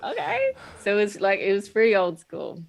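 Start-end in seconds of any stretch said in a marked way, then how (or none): 0.0-0.5 high surprised okay. Retold or imitated. Retelling her reaction to the teacher.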